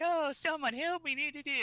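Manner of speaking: high, fluttery damsel in distress; fluttering cries for help. Retold or imitated. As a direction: squeal for help like a helpless damsel. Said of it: "she's like".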